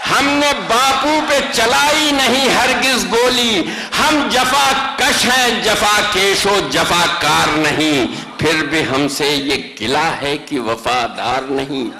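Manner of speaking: rising, declamatory mushaira recitation; stately nazm recitation. Acted. Poet recites nazm stanza. (پرجوش مشاعرے کی نظم خوانی)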